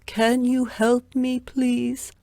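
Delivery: high pleading voice; poor man begging. Acted. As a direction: beg a stranger for help. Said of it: "the man asked Zheng".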